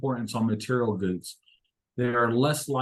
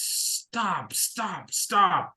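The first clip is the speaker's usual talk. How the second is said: higher-pitched but held-back imitation of yelling; storyteller's restrained shout at a dog; mock angry yell; 5 semitones higher, much slower than usual. A narrator's reading. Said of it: "starts shouting and yelling at the dog".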